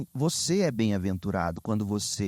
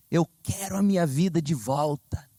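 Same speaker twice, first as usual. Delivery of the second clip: a hint of a desperate mother's plea, higher; quoting a desperate mother, pitched up; 6 semitones higher than usual. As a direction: lightly play a worn-out mother begging. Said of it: "Em desespero, ela gritando".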